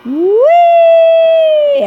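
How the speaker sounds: long high squeal of delight; gleeful whee. Real, not acted. Player flung into the air, joyful high whee. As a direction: ride the launch like a rollercoaster.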